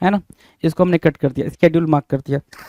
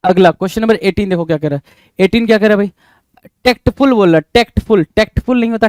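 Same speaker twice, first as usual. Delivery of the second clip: ordinary talk, nothing marked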